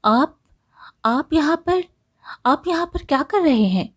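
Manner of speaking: taken aback, pitch lifted; surprised. A narrator's reading. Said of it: "वेदिका काफ़ी हैरान हुई".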